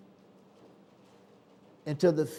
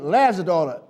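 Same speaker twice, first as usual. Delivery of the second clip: usual speaking voice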